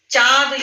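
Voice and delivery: चाँद said as चाद, with a purely oral vowel; no nasal sound. Shown as a demonstration. Teacher shows children the wrong चाँद, without nasality. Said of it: "अब हम चाँद तो नहीं बोलते हैं".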